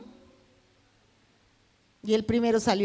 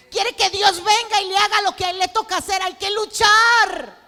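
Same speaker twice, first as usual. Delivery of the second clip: preaching at full force; 7 semitones higher, 8 dB louder than usual. Acted. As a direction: exhort the church to fight. (predicación con fuerza, hasta gritar)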